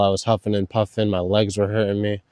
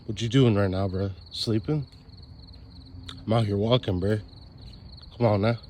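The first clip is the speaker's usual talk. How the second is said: phone voice memo; 9 dB quieter than usual. Pretend. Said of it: "sending my boy things like this".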